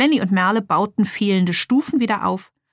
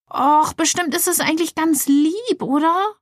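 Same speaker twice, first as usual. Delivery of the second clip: put-on much higher girl's voice, not truly whispered; mock whisper; 8 semitones higher than usual. Merle hopes aloud the wild piggy bank is sweet, high cooing. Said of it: "flüsterte sie".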